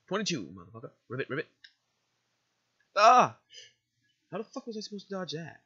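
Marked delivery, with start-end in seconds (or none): 3.0-3.4 short, loud, high yelp; sudden cry of alarm; startled yell. Real, not acted. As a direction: yelp as if just hit. Player hit during game fight.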